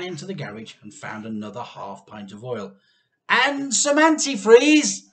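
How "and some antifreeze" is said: high, loud, slow call; calling after. A narrator's reading. George calling to Mr Cranky, loud call. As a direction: call after him as he runs. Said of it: "George called after him".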